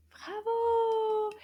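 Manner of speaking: high cheering bravo; delighted kids' show praise; excited praise. Acted. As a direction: cheer the little one on. Praising child's answer.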